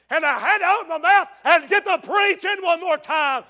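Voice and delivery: loud, high, slow shouting sermon; fired-up preaching. Real, not acted. Preacher exhorts the church, slow loud shout. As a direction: drive it home to the pews.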